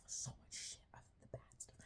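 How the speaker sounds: whispered aside; conspiratorial whisper. Real, not acted. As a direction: drop to a secret whisper. Unvoiced aside.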